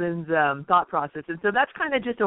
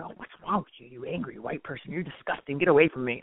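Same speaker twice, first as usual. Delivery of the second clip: hushed, much softer imitation of a scornful critic; mock disgust; 4 semitones lower, 6 dB quieter than usual. Mimicking critics who shun an angry person, quietly disgusted. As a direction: recoil in put-on disgust, under the voice.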